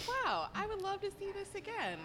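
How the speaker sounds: excited. Retold or imitated. Retold excited reaction at event.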